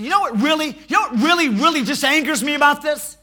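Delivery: loud high building shout; furious rant. Retold or imitated. David ranting about the heartless rich man. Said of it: "David said".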